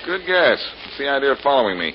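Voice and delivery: loud, high, quick challenge; confrontational. Acted. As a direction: confront the stranger head-on. Challenges the man following him.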